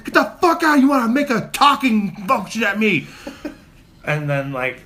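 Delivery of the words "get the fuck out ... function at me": high, loud yelling with laughter breaking through; angry yelling. Retold or imitated. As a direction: scream out the window, half laughing. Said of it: "I roll my window down and I'm like".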